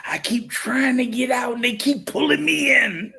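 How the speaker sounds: high, theatrical movie-line delivery; mock Godfather line; Godfather quote. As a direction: quote the mobster's lament with a dramatic lift. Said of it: "what my man say, in The Godfather".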